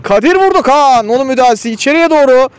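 sudden high excited shout of the shot; excited commentator. Real, not acted. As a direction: jump up as the shot comes in. Shot saved, live commentary.

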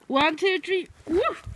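high, loud count-off; excited countdown. Real, not acted. Counting for Trixie before the throw, excited high countdown.

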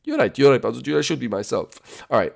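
ordinary delivery

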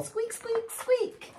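tiny very high-pitched squeaks; mouse squeak. A narrator's reading. Storyteller voices the mouse for preschoolers, high squeaks. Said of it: "the mouse went squeak".

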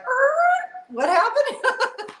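a squeaky high, very slow, laughing what; hammed-up confusion with a laugh; mock bewilderment. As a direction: play it baffled and amused. Beautiful moment takes left turn.

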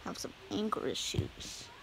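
whispered admission; whispered confession of anger issues. Acted. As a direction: breathe it out under the breath. Admitting problem under breath.

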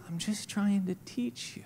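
hushed, patient reply; gentle reassurance. Retold or imitated. God answers a complaining believer, soft and patient. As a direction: soften right down to soothe the complainer.

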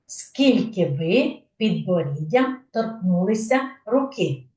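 usual speaking voice

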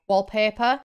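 usual speaking voice

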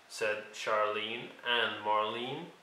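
ordinary delivery

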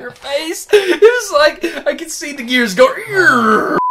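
laughing through the words; giggling while teasing a friend. Real, not acted. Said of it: "laughing while teasing".

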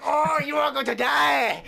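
shouted mock Japanese accent; hammy fake Japanese dub voice. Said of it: "me doing a horrible Japanese accent".

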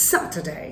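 "Saturday" as SAT-uh-day; stress on first syllable. Shown as a demonstration. Teacher modelling weekday stress, first syllable punched. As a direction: hit the first syllable of "Saturday", glide the ending. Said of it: "নামের প্রথমে stress দিতে হবে".

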